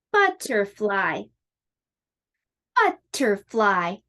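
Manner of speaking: slow Butterfly, each syllable spaced like a clap; clapped syllables. Shown as a demonstration. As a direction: say Butterfly slowly, one beat per syllable. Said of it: "Now I'm gonna clap the word".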